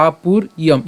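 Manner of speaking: "আপুর ইয়ম" broken into two parts; split pronunciation. Shown as a demonstration. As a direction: pause between "আপুর" and "ইয়ম". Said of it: "ইয়ম কেন". ("আপুর ইয়ম" ভেঙে ভেঙে বলা)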